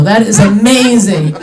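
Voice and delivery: ordinary delivery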